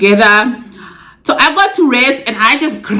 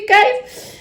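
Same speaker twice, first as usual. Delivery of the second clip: laugh-cry. Real, not acted. Tearful laugh recalling crying.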